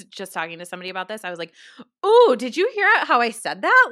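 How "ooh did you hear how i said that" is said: high, loud excited ooh; playfully startled at her own voice; mock surprise. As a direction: replay the moment you caught yourself. Retelling noticing her own voice.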